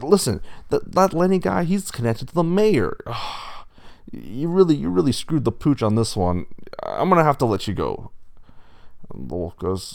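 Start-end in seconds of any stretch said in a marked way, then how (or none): 0.0-3.0 high, loud reprimand; exasperated bull boss. A narrator's reading. Bull warns wolf about Lenny's connections, exasperated. 3.0-4.1 long, soft breath out; exasperated sigh. A narrator's reading. Bull sighs over the wolf's mistake, exasperated. Boss sighs mid reprimand. 4.1-8.1 raised, louder firing speech; exasperated bull boss. A narrator's reading. Bull boss fires the wolf, exasperated.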